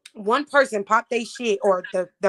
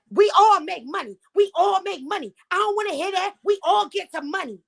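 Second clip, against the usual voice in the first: raised, insistent retold rant; imitated yelling; 8 semitones higher than usual. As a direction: mimic someone hollering over the crowd. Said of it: "And why is y'all yelling?".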